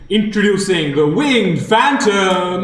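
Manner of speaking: slow, booming arena announcer over a speaker; crackly commentator's box PA voice; tinny announcer voice. Acted. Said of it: "tinny, uh, voice from a commentator's box".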